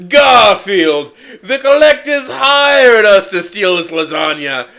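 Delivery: loud, high gloating taunts; hammed-up baddie roar; villain shouting. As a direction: bellow threats at the hero. Villain leader taunts Garfield.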